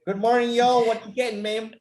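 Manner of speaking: loud, high Southern waiter greeting; very Southern style. Retold or imitated. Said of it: "Very Southern style".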